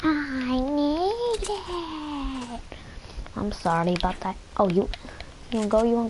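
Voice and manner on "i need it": high, very slow, stretched-out whine; whiny plea. Pretend. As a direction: drag it out like a begging kid. Drawn-out begging whine.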